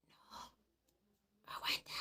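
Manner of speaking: barely audible whisper. Retold or imitated. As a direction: whisper hold on, hardly breathing. Omar hushing her.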